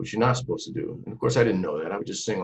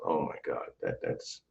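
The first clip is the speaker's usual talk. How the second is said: soft, half-whispered amazement; hushed, starstruck, to yourself; lightly voiced wonder, breath dropping away; awed murmur; 5 semitones lower, 10 dB quieter than usual. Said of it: "just going".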